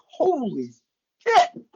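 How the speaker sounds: high, disbelieving outburst, slowing at the end; astonished. Retold or imitated. Announcer recalling his amazement at the score, high and incredulous. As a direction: blurt it out, then stretch the last questions in wonder. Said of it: "I'm saying, like".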